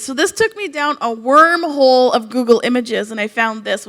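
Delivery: usual speaking voice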